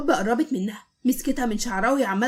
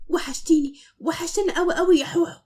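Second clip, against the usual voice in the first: tender, lifted greeting; loving; 5 semitones higher than usual. A narrator's reading. Man greeting his beloved Hob with fond longing. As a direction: coo it affectionately. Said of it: "بكل حب".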